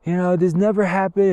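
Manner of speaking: high, slow, droning mock complaint; mocking a nagging inner doubter; mimicking negative thoughts. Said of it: "you get in those thoughts of like".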